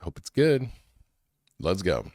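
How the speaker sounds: ordinary talk, nothing marked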